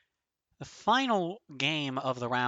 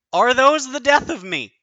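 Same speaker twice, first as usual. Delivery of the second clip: tense outcry; 10 semitones higher, 8 dB louder than usual. Real, not acted. Facing the interceptors.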